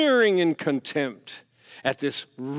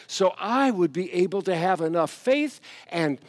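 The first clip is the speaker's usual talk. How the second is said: loud, raised sermon delivery; fiery preaching; 7 semitones higher, 5 dB louder than usual. Acted. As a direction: preach it out with fire. Preaching at full force.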